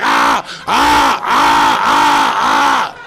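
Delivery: loud, low mock screaming of a woman having her hair pulled; mock pained screams. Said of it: "and she's like".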